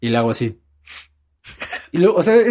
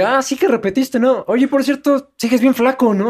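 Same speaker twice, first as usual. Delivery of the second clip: mocking blunt mother; 9 semitones higher than usual. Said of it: "sí fue como de".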